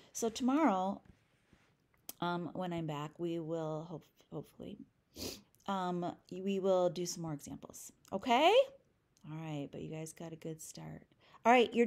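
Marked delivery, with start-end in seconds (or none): none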